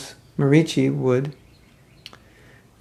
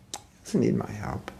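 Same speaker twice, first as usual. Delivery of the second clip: whispered reply; 3 semitones lower, 8 dB quieter than usual. Retold or imitated. Lakshman's quiet reply.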